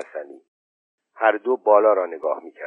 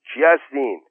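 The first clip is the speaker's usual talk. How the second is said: calling out; 5 semitones higher than usual. A narrator's reading. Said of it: "ننه خانم از بالای دره داد زد".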